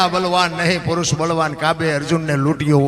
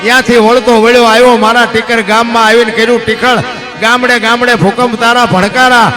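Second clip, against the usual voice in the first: rhythmic chanted verse recital, steady driving beat; bard's lilting verse delivery; folk poem recital; 5 semitones higher, 7 dB louder than usual. Acted. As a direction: recite the earthquake ballad like a village bard.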